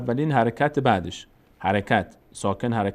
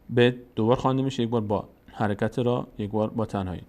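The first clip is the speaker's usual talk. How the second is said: usual speaking voice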